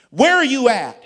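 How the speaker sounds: high, booming mock demand; loud demand. Senior pastor quizzing Philip by phone, loud high demand.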